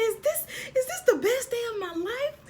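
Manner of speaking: high, loud, rising disbelief; overwhelmed excitement. Retold or imitated. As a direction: squeal the question as if overcome. Acted overwhelmed fan.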